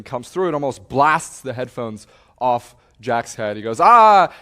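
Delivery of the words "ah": loud, high mock yell; angry startled yell. Radio operator blasted by his headphones, angry startled yell. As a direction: imitate a yelp at an ear-splitting blast. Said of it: "and gets really, really pissed off".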